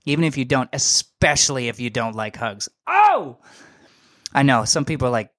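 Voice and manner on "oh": a sudden high yelp of fake shock; mock surprise. Mocking listeners who dislike hugs with a sharp high yelp. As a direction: play up the shock. Mock gasp at hug-haters.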